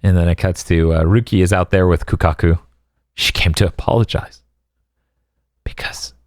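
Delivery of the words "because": dramatic whisper. Pretend. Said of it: "dramatic whispered aside".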